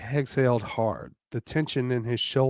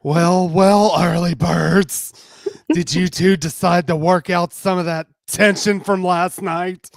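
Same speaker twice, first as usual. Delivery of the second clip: higher, louder teasing; Justine voice; 6 semitones higher, 10 dB louder than usual. Acted. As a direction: needle them with a sly grin.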